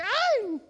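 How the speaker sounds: high mock "ay!" of pain; pained yelp. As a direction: yelp as if a sore spot was poked. Acts out touched wound.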